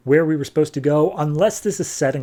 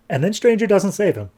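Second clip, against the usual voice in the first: usual speaking voice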